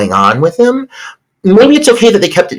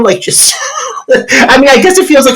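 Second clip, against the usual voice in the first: laughing; 12 semitones higher than usual. Real, not acted. Laughing at lame credits.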